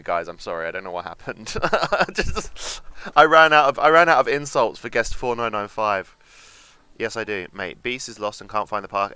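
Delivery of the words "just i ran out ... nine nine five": laughing, much louder and higher; real laughing. Real, not acted. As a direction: laugh through the apology. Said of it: "That ran away with me".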